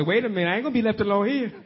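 fast and rushed; young accuser scrambling not to be left behind, fast; hurried young voice. Retold or imitated. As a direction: blurt it out quickly, in a panic to leave. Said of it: "them young spry ones".

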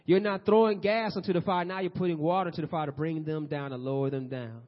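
normal talking voice

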